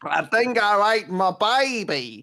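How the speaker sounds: slow, high, hammed-up Australian accent; bad Australian accent. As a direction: wail it in a mock Australian accent. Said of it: "worse than they do Australian".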